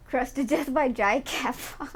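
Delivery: ordinary talk, nothing marked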